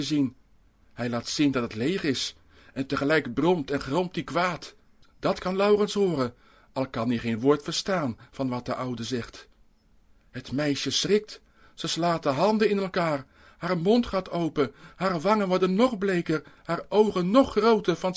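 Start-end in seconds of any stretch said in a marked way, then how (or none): none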